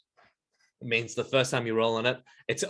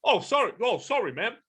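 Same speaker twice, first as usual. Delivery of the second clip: high, flustered gorilla apology; startled, high-pitched character sorry; shocked gorilla; 11 semitones higher, 3 dB louder than usual. Acted. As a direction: apologise in a put-on voice, taken aback. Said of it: "completely shocked out of their anger".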